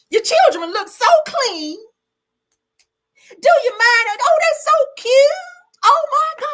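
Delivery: Miss Millie. Retold or imitated. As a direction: coo over the children, high and syrupy. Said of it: "Y'all remember Miss Millie".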